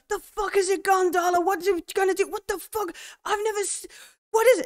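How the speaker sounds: high, loud frantic terror; panicking soldier as the thing swoops past; terrified panic. Acted. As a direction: scream the questions in fear. Farouk panics as the creature passes.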